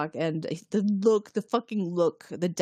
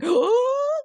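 a high, loud, startled "Oh!"; shocked gasp; 6 dB louder than usual. Retold or imitated. Riker startled by his son, high shocked "Oh!". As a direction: jolt back in sudden shock.

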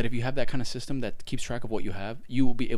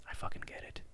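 unvoiced emphatic whisper; 13 dB quieter than usual. Complaining to Jasmine, a sudden whisper. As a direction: hiss it under the breath.

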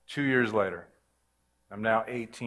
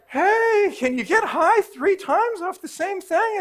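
high incredulous question; 4 dB louder, slower than usual. Retold or imitated. Wondering mid-prayer about getting high.

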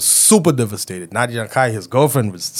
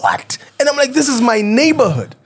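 high, stunned outburst of disbelief; shocked disbelief; 11 semitones higher than usual. Retold or imitated. Telling a friend about crime nearby, shocked. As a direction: gasp it out like you can't believe it's your street.

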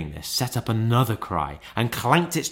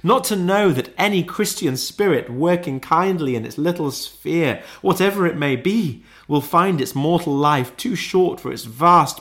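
higher, impassioned ghostly lament; phantom's cry; 5 semitones higher, 3 dB louder than usual. A narrator's reading. Ghost lamenting its misused life, crying out higher. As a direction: declaim with mounting regret. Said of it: "cried the phantom".

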